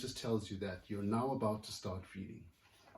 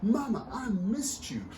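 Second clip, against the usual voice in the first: put-on excited little girl; 8 semitones higher than usual. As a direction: pour out joy to her returning mother. Astrid reunites with Mama.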